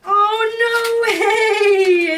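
high, loud, drawn-out squeal of delight; real squealing joy; excited shriek. Real, not acted. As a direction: shriek it, overjoyed at the surprise. Opening gift card, thrilled.

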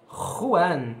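"Juan" com J gutural, soando como R forte; Juan em espanhol. Shown as a demonstration. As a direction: raspe a garganta no começo de "Juan". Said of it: "esse J é o J do espanhol". ("Juan" à espanhola)

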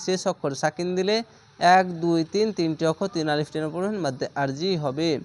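ordinary delivery

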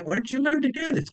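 high, wide-eyed asking; a boy's amazed high question. Retold or imitated. Asking Ronnie about backflip.

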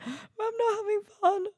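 imitating her own tearful wail; mock crying. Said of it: "And I was like".

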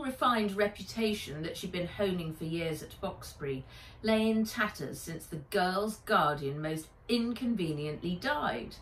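plain reading voice

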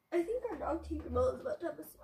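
ordinary talk, nothing marked

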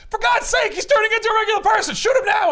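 high, loud, fast yelled order; mock yell. Arliss yelling at Yith to shoot now, high and loud. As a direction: bellow it like a panicking villain. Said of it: "Arliss is yelling at Yith".

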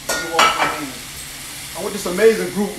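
ordinary talk

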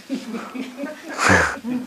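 high squealing giggle; amused high-pitched giggle; high laugh. Real, not acted. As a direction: let a high laugh slip out. Laughing at own answer.

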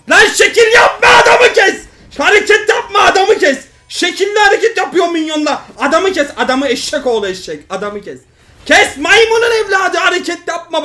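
loud, high yelling at a teammate; raging at a teammate to just kill the target; furious shout. Real, not acted. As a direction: scream orders at the screen.

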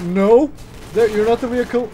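loud frustrated yell; shouting. Real, not acted. Yelling at teammate in game.

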